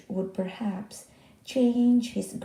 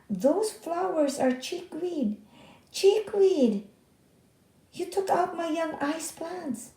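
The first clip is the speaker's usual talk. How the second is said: angry outburst; 9 semitones higher than usual. Acted. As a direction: spit out Chickweed! in fury. Ben angry about chickweed.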